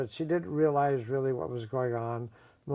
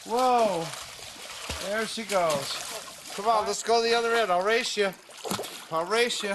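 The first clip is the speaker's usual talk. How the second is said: excited cheering; 9 semitones higher than usual. Real, not acted. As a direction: cheer her on laughing. Son laughing, cheering mother in pool.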